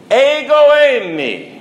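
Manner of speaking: loud, high, slow proclamation of ego eimi; commanding declaration. Acted. The Lord to the guards, a loud, slow, high declaration. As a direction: ring it out like God naming himself.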